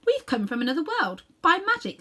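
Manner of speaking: Polly pushing to be noticed; eager girl. Acted. Polly cuts in to get the Queen's notice, high and eager. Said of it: "said Polly".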